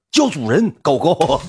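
loud, high-pitched order to a pet; mock master's command. Supporter ordering the streamer to say master, loud mock command. 演出对方命令叫主人.

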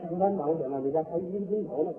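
hushed polite back-and-forth, pitched low; soft, courteous murmur. Acted. Loving couple urging tea on each other, soft low courtesy.

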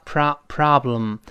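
"problem" with an open American "ah" vowel; American pronunciation. Shown as a demonstration. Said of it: "美国人呢变成啊".

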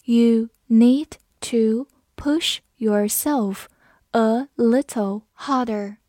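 very slow, word by word with pauses; slow reading. Shown as a demonstration. Teacher reads the sentence slowly for students, slow reading. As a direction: read it out slowly, each word separate. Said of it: "我们慢慢来读".

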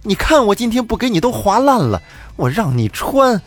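high-pitched scolding rant; storyteller's voiced shrewish tirade; yelling while slashing. A narrator's reading. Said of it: "边划还边喊".

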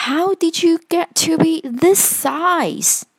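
high-pitched, amazed question; surprised. A narrator's reading. Eddie to a giant teddy he takes for Freddy, surprised. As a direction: ask it in astonishment, voice lifted. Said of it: "What a surprise".

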